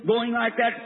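ordinary delivery

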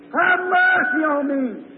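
slow, high plea; insistent, drawn-out beggar's call. Acted. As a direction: stretch out a high cry for mercy. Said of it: "he screamed that much louder".

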